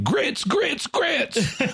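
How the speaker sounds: high-pitched repeated cry; mock shouting. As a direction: yell each word like a desperate chant. Reenacting cartoon character's shouting.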